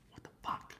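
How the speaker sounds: breathy whispered aside; hushed unvoiced curse. Real, not acted. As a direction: drop to a whisper, almost to yourself.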